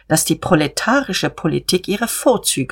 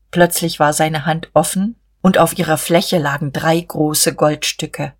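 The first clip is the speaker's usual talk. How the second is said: plain reading voice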